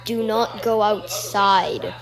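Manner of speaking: normal talking voice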